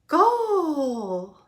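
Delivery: high, loud drawn-out shout; goal cheer. A narrator's reading. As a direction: cry out a goal, long and falling. Goal scored in the match.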